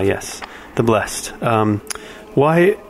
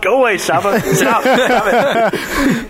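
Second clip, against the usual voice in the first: much higher, playful shooing; high mock-annoyed voice; 11 semitones higher, slower than usual. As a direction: squeak it out in pretend annoyance. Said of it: "The little bubble pops up in my head".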